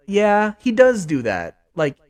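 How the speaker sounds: ordinary talk, nothing marked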